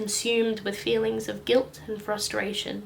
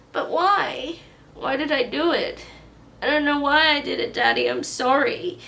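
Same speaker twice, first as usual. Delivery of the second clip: high, loud tearful outburst; regressed sobbing; 6 semitones higher, 8 dB louder than usual. Acted. Boy sobbing his apology to Daddy, high and loud. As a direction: break down crying and apologize.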